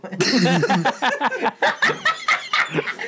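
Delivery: loud, high burst of laughter that falls away; cracking up at a friend's joke; big laugh. Real, not acted. Laughing at a friend's Street Fighter joke, big burst of laughter. Friends joking about bad movie.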